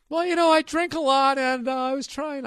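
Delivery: high, loud, flustered mock excuse. Caught by a TV reporter, he squeaks out a flustered, high, loud excuse.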